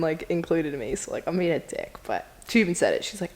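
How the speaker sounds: ordinary talk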